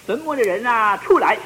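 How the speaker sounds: mock loud call. Imagined call to all the dead.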